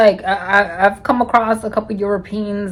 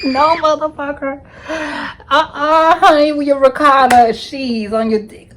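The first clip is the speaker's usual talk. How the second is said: high, sassy no way; turning her hookup down, high and sassy; sassy refusal; 5 semitones higher than usual. Retold or imitated.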